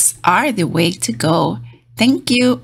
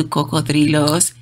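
ordinary delivery

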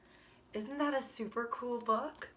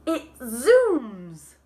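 drawn-out reading; 2 semitones higher, 4 dB louder, much slower than usual. Acted. Reading the ride's plunge.

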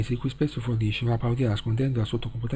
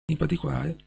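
losing voice; 5 semitones higher than usual. Real, not acted. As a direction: voice cracking, strained and high. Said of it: "mi è andata via la voce".

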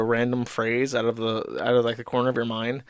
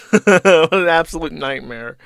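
said through a laugh, louder and higher; 4 semitones higher, 7 dB louder than usual. Real, not acted. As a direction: burst out laughing as you say it. Laughing at the news read out.

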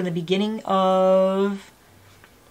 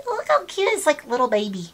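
cooing; 6 semitones higher than usual. Real, not acted. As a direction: coo over something tiny. Cooing over tiny product.

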